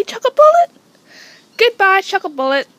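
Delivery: high, loud send-off to an animal; calling to pet. Real, not acted. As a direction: call out a loud goodbye to your pet. Releasing guinea pig with a command.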